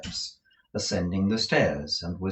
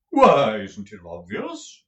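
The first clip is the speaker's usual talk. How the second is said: slow, theatrical actor's voice; pompous tragedian; 6 semitones higher, 11 dB louder, slower than usual. A narrator's reading. Lenville admiring Nicholas's room, slow and grand. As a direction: roll it out like a stage tragedian. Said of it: "reasoned Mister Lenville".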